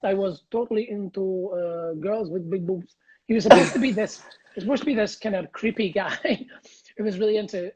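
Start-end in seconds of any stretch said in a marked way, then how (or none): 0.0-2.9 light Russian accent, leering; a sleazy Lothario in a mild Russian accent; a hint of a Russian accent; slight Russian accent. Shown as a demonstration. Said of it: "slight little Russian accent like this".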